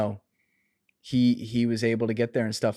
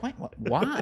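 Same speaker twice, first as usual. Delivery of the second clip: mock confused Joe; 11 semitones higher, 3 dB quieter, slower than usual. Imitating Joe's confused reaction.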